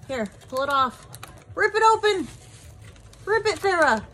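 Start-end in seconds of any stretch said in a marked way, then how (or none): 1.6-4.0 excited urging. Real, not acted. Urging bird to tear bag.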